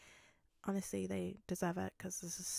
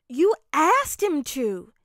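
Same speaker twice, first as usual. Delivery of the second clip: loud, high, drawn-out rebuttal; firm intervention; 6 semitones higher, 15 dB louder, slower than usual. Acted. Kirishima informing Bakugo during the fight, loud and firm. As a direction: push back hard, high and slow.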